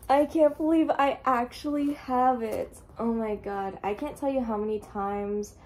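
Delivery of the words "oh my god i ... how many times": ordinary delivery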